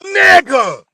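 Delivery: even bigger full-volume shout. Shown as a demonstration. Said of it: "We need more".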